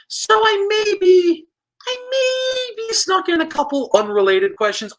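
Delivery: sheepish squeak. Acted. Sheepish confession to viewers.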